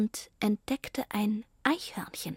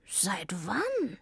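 hushed question; 2 semitones lower, 5 dB quieter than usual. Acted. Cat asks in hushed voice.

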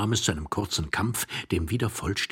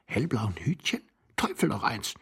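hushed, despairing moan rising higher; desperate moaning; 8 semitones higher than usual. Acted. Hidden husband recognizing his wife's blue hat, desperate moaning. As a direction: groan in horror, climbing at the end. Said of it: "stöhnte Iwan Andrejewitsch wie ein Verzweifelter".

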